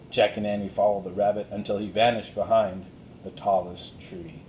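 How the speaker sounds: usual speaking voice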